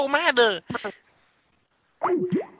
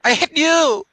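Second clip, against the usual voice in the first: mock yell; 5 semitones higher, 5 dB louder than usual. Caller yells back angrily.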